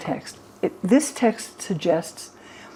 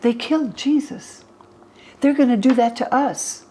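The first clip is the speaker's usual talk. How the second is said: retold panic, voice pitched higher and louder; terrified disciples; 5 semitones higher, 4 dB louder than usual. Frightened disciples telling each other they're next, mock terrified. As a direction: play the frightened disciples, urgent and rising. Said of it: "in which the disciples are terrified".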